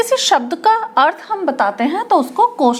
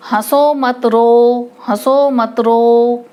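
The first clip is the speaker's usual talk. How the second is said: slow, louder, level singsong with no comma pause; run-together reading; 3 semitones lower, 4 dB louder, much slower than usual. Shown as a demonstration. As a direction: draw out "हंसो मत रो" evenly as one phrase. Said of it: "अब इसमें मुझे फ़र्क बताओ".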